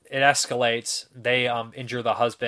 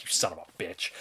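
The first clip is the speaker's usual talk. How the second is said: muttered curse; 6 semitones lower, 6 dB quieter than usual. Retold or imitated. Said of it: "I was like".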